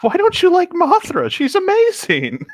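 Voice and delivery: very high, outraged defence of Mothra; high indignant squeal. Real, not acted. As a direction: squeal in disbelief. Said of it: "We stand Mothra".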